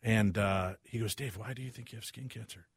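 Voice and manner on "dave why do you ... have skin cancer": very soft, gentle question; hushed imitation of a mild-mannered physician; lovely little doctor. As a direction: ask softly as a kindly doctor. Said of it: "this lovely little guy".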